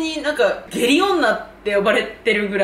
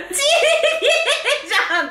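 laughing recall; much slower than usual. Retold or imitated. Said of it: "とか思って".

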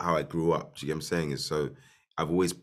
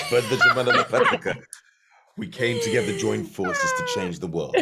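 epic movie trailer voice; grand mock announcement; 7 semitones higher, 7 dB louder than usual. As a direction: proclaim it like a trailer.

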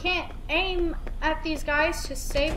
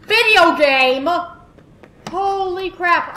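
louder and slower, genuinely angry; angry outburst; 3 semitones higher, 10 dB louder, slower than usual. Real, not acted. Frustrated player ranting at the game, angry outburst. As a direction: let the anger burst out. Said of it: "I'm actually angry right now".

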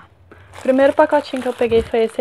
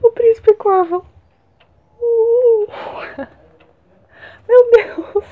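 high, loud, squealing delight; real overjoyed fan squeal; ecstatic reveal; 8 semitones higher, 4 dB louder, slower than usual. Real, not acted. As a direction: announce it like a long-wished gift. Reveals the book she had wished for.

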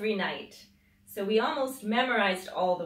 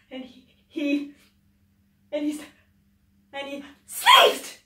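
building tension bursting loud on sneezed; building to a sneeze; 7 semitones higher, 11 dB louder than usual. A narrator's reading. Reader acting out the fish's sneeze, building up to a loud burst. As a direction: stretch the pause then burst out loud.

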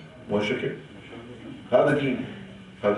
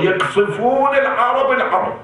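higher, slow, outraged repetition; indignant outcry; 9 semitones higher, 3 dB louder than usual. Real, not acted. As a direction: drag out the outrage on the repeated word. Indignant preacher decrying bombing. (صرخة استنكار بطيئة وعالية النبرة)

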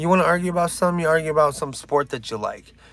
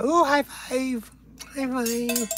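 mock eager offer; 9 semitones higher, 4 dB quieter, much slower than usual. Mock eager high five offer.

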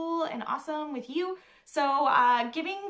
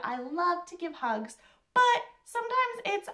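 ordinary delivery

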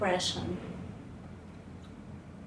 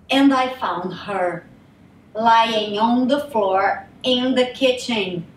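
loud, heavy telling of a horror; building anguish; 2 semitones higher, 10 dB louder than usual. Acted. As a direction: force out the painful memory, slowing at the end. Recounts finding her on the floor.

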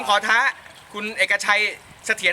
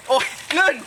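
gasping from icy water; in ice cold water; 5 semitones higher than usual. Real, not acted. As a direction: yelp at the freezing cold.

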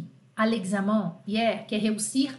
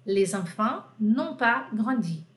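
slow model negative; 3 semitones lower, slower than usual. Shown as a demonstration.

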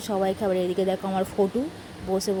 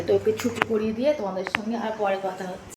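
usual speaking voice

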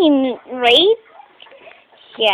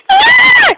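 high, loud mock horror shriek; pretend scream; 9 dB louder than usual. Kid acting the movie killing, high pretend scream. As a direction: let out a piercing play scream. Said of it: "Wow, that was a scream".

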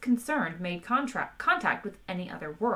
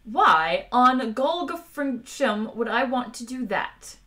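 slow, higher, puzzled captain; a louder, drawn-out bemused question; mild bemusement; 5 semitones higher, 5 dB louder, slower than usual. A narrator's reading. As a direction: ask it slowly, genuinely baffled. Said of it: "peered at him in bemusement".

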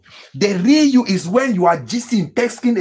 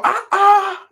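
high, drawn-out mocking "ah, ah"; incredulous ah ah; 12 semitones higher than usual. Real, not acted. Pastor scoffing at the congregation with a high "ah, ah". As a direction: exclaim in disbelief, pitched high.

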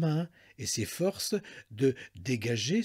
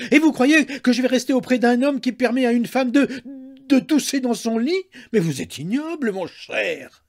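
loud, high, breathless outrage; frantic indignation; 10 semitones higher, 9 dB louder than usual. A narrator's reading. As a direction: rant in scandalised fury. Frantic wife repeats her outraged tirade.

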